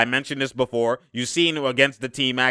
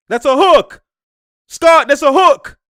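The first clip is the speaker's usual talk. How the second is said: loud protest; 12 semitones higher, 10 dB louder, slower than usual. Real, not acted. Yelling at ref on screen.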